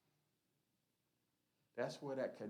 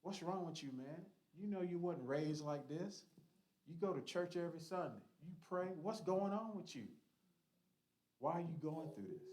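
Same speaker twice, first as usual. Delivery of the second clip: higher-pitched, pressing questions; concerned confrontation; 6 semitones higher than usual. Acted. Church member questioning a struggling friend, concerned confrontation.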